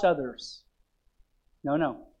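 tender quiet preaching; soft pulpit hush. Acted. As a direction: pull them in close, quietly.

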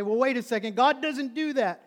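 loud, high protest; shouted disbelief of an objector; indignant objection. Acted. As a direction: protest it hard, stretching the last words. Acted objector arguing with preacher.

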